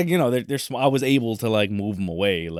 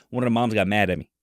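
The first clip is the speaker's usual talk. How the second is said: ordinary talk, nothing marked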